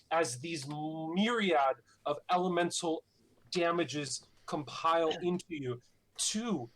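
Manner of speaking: dramatic fantasy narration; story narration. A narrator's reading. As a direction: paint the battle like a storyteller. GM narrates dragon breath attacks.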